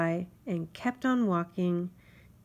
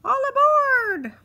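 high, much louder, slow ferryman call; mosquito shouting; 11 dB louder, slower than usual. Acted. Mosquito announces departure, high slow loud shout. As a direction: call everyone aboard like a tiny captain. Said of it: "shouted the mosquito".

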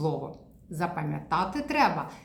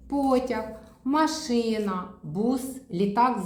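slow word list; 5 semitones higher than usual. Shown as a demonstration. Therapist reading the picture row slowly to a child. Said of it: "Ми вивчаємо слово потяг".

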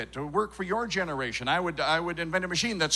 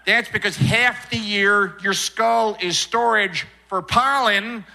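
put-on loud, exasperated Minnesota accent; 4 semitones higher, 4 dB louder than usual. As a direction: build to a stretched-out wail in Minnesota accent. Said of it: "where everyone talks like this the whole time".

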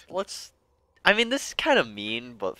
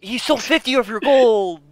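high, loud outraged protest; loud indignation; 11 semitones higher, 8 dB louder than usual. Real, not acted. Player telling friend about the stolen gold, loudly indignant. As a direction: make the theft sound outrageous.

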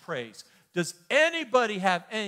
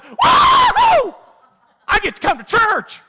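huge high whoop, much louder; excited whoop; 19 dB louder, slower than usual. Pretend. Preacher mocking churchgoers who should arrive thrilled, whooping. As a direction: burst in thrilled. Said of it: "came in the door and said".

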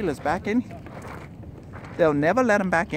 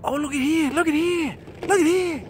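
excited; 7 semitones higher than usual. Real, not acted. Spotting droppings on the trail.

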